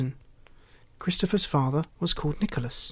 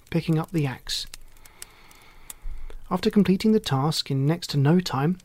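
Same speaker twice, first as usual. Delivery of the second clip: plain reading voice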